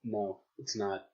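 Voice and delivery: flat, slow and quiet no; dry deadpan put-down. Real, not acted.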